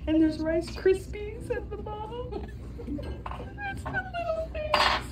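high, tearful, wavering voice; trying not to cry from joy; holding back tears. Real, not acted. Vlogger fighting happy tears at the dessert. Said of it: "that's me trying not to cry".

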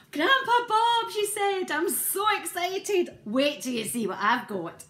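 high, loud excited squeal; excited child. Acted. Maisie bursting with excitement to Grandpa Bob.

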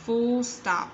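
usual speaking voice

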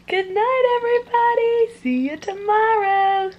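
high sing-song mock good night; pretend bedtime sign-off. Vlogger pretending to turn in for the camera, high sing-song farewell. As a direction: draw out a put-on, high-pitched good night. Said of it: "pretend to go to bed".

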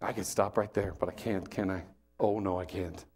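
low aside. Shown as a demonstration. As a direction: drop right down as if talking to yourself.

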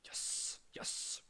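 whispered celebration; a hushed little victory; whispering. Real, not acted. Quiet win on the score.

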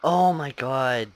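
drawn-out shocked groan; stretched, loud disbelief; loud slow oh my god. Real, not acted. Near miss in hard game.

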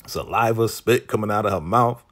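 normal talking voice

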